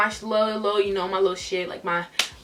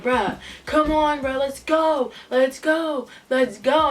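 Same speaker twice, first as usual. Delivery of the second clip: higher-pitched pleading to leave; urging friend; 4 semitones higher, slower than usual. Retold or imitated. Best friend trying to get her out, insistent urging. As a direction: imitate a friend hurrying someone out.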